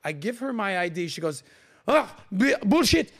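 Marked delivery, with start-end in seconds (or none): 1.9-3.1 high, loud scoffing outburst; mock outrage. French girl rejecting his ID, mock outrage. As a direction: burst out in imitated disgust.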